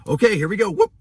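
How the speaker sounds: mocking an offhand ultrasound tech; careless tech. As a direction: toss it off like it's nothing. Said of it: "It was just".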